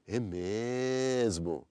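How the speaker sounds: "É mesmo", "mesmo" dragged out slow and falling; stretched-out mesmo. Shown as a demonstration. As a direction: say "É mesmo." very slowly, drawing "mesmo" out long with a falling tune. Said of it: "really stretch out that word mesmo".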